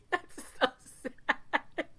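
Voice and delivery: that's so sad, broken by laughter; laughing. Real, not acted. Teller cracking up at the friend's misplaced sadness, laughing. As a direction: laugh through the words.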